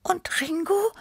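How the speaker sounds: very high, small questioning voice; small cat Tippi. A narrator's reading. Small cat asks after the drummer, high-voiced questioning.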